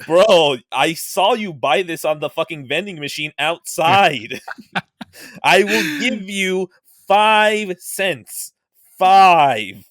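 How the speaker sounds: loud, high mock scorn, slow at the offer; scoffing dealer. Pawn dealer mocking the customer with a loud, slow lowball.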